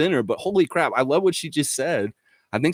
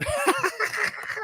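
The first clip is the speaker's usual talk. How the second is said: very high squeaky laugh; high squeal laugh; 5 dB quieter than usual. Real, not acted. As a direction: let out a shrill giggle.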